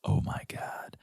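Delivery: barely voiced, breathless "oh my God"; mouthed whisper. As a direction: mouth it silently, just a breath of air. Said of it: "walks towards her and mouths".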